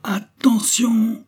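drawn-out, very high wolf-like call; stretched-out warning cry; long slow howl. A narrator's reading. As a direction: howl the word slowly like a wolf. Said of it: "hurla lentement et longuement".

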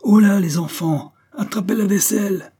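high, loud call from a window; high-pitched hollered warning; shouting to the crowd. A narrator's reading. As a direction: hail the crowd below. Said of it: "et criait à la foule".

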